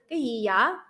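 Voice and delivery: Southern Vietnamese, gì and vậy with y sounds; Southern Vietnamese accent. Shown as a demonstration. Contrasting South with North for listeners, Southern Vietnamese accent. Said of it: "In the South is".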